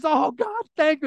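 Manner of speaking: high, exclaimed mock prayer; relieved thanks. Evangelist relieved, thanking God.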